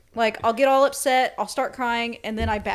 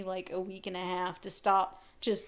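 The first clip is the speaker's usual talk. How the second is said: ordinary talk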